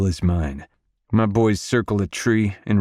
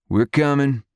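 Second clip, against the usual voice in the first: high, contained yell in reply; yelling back; 8 semitones higher, 3 dB louder than usual. A narrator's reading. Sebastian answering his wife from outside, yelling back. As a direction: call back across the yard. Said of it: "Sebastian yells back".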